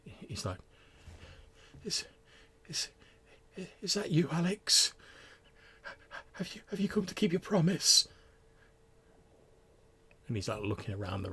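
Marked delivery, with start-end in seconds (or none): 1.8-8.1 put-on high, frail, halting old man's voice; a confused old patient stammering in the dark; frail bewildered old man. Old patient asks the shadowy visitor, frail and bewildered. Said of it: "in a slightly bewildered and quite frail sounding voice".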